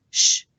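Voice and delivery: sh as a loud, pure hush sound; correct sch sound. Shown as a demonstration. Teacher showing what S C H makes, a loud sh. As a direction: sound out sh on its own, loudly. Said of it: "S C H that we learnt in our phonetics lesson".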